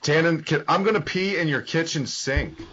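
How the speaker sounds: mock threat. Threatening friend on phone.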